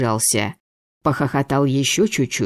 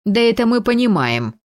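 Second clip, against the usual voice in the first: high, impatient committee retort; weary bark; 8 semitones higher than usual. A narrator's reading. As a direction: bark it wearily, pitched up. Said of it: "рявкнула уставшая комиссия". (усталое рявканье)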